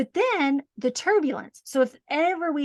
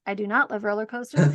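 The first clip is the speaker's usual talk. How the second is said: usual speaking voice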